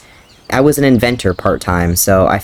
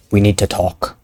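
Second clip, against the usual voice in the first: stoic dad. Acted. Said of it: "getting into character".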